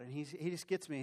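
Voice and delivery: ordinary delivery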